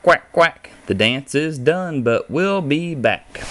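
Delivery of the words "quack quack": louder duck quacks; quacking duck. A narrator's reading. Barnyard book read aloud, a louder duck quack. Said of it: "With an oink and a moo and a quack".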